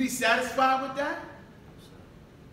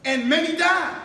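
preaching at full force; 3 semitones higher, 4 dB louder than usual. Acted. Minister challenging the congregation with rising, shouted preaching. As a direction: build the questions into a rousing shout.

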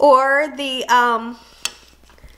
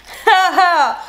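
bright laugh; 6 semitones higher, 4 dB louder than usual. Real, not acted. Laughing at her own joke.

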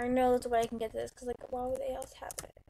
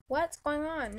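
ordinary talk, nothing marked

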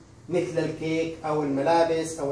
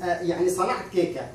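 usual speaking voice

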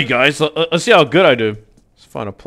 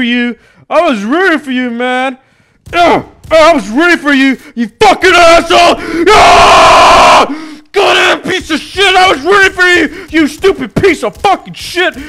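furious yelling; 14 semitones higher, 9 dB louder than usual. Real, not acted. Rage at teammate.

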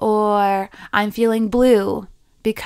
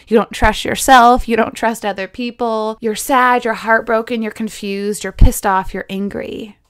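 normal talking voice

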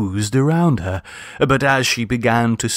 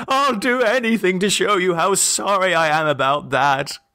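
high, pleading promise; father desperate to save his daughter; desperate cry; 8 semitones higher than usual. A narrator's reading. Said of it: "cried Octavian".

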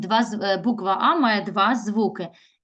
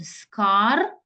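"Скарбниця" as Скар, a pause, then бниця; another word split; slower than usual. Shown as a demonstration. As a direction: break "Скарбниця" between р and б. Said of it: "Або інакше".

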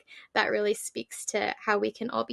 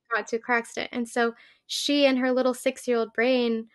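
ordinary talk

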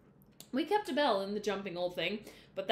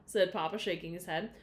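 ordinary delivery, nothing marked

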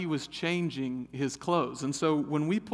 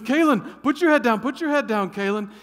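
loud high urgent order, easing at the end; urgent command; 8 semitones higher, 9 dB louder than usual. Retold or imitated. Brother ordering sister to hide her eyes, urgent and loud. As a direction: shield your little sister fast. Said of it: "He goes".

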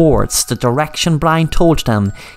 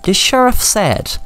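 plain reading voice

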